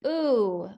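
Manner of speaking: "Ooh" held alone as the vowel ending bamboo; long oo sound. Shown as a demonstration. Teacher answering her spelling question, long isolated "Ooh". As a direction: draw out "Ooh" as in moon. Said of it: "What's the last sound in bamboo?".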